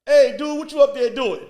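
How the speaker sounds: loud, high heckler calling out; heckling partygoer. Retold or imitated. Party guest heckling the comic onstage, loud and high. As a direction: shout at the comic from the crowd.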